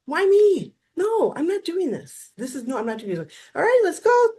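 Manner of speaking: high, loud, wailing refusal; whiny outburst. Retold or imitated. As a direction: throw a fit over the assignment. Retelling his protest, then the assignment.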